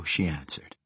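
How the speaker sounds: ordinary delivery, nothing marked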